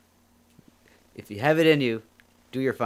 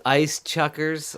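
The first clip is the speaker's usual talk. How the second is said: savoring the name; 5 semitones higher, 4 dB louder, much slower than usual. Shown as a demonstration. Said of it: "savouring a suggested name".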